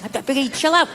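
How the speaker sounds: usual speaking voice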